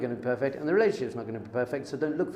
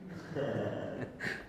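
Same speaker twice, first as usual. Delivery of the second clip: soft laugh; 4 semitones lower, 11 dB quieter than usual. Real, not acted. Laughs at son's mistake.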